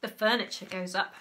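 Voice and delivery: usual speaking voice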